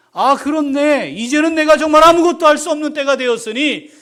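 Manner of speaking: loud, high, exultant cry; preacher voicing Moses' triumphant insight; joyful realization. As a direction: burst out with the discovery. Moses realizing God's power.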